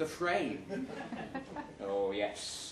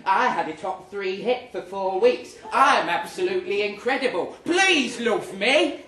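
louder and higher, building to a pleading whine; mock boastful sign; 5 semitones higher, 9 dB louder than usual. Diarist mocking Jolene's imagined sign, rising to a loud whine. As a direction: ham up a desperate show-off. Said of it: "a neon sign above it saying".

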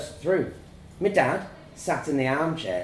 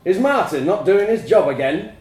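loud, higher-pitched irritated dad; booming; 5 semitones higher, 8 dB louder than usual. A narrator's reading. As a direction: demand it at booming volume. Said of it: "he boomed".

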